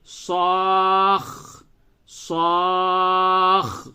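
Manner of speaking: صاخ drawn out very slowly, kha held long; doubled kha. Shown as a demonstration. Teacher models the doubled kha very slowly. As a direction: stretch صاخ and hold the final kha. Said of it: "اس طرح سے practice کیجئے".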